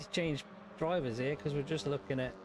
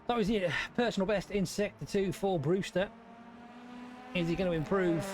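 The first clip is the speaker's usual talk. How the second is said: race commentary; 5 semitones higher than usual. Acted.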